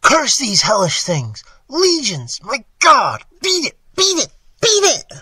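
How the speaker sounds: loud horror climbing to a very high shriek; cry rising to shriek. A narrator's reading. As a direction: start in a cursing cry, end shrieking. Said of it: "a cry that gradually rose to a shriek".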